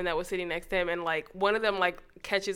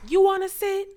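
high, raised-voice mock offer; imitating loud man; 11 semitones higher, 6 dB louder than usual. Loud man on a bus offering his seat around, high imitating call. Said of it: "there was this loud-ass man".

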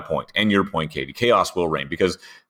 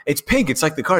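acted quote; 10 semitones higher than usual. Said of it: "He's like".

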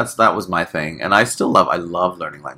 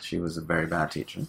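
ordinary delivery

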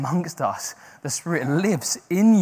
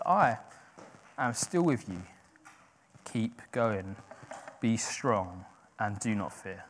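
quiet promise; 5 semitones lower, 9 dB quieter, slower than usual. Acted. God's promise, closing softly.

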